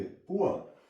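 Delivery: पुअर as poo-a with no r; silent r. Shown as a demonstration. Teacher gives students the right पुअर. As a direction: end पुअर softly on a, drop the r. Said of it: "पुअर नहीं से पढ़ेंगे".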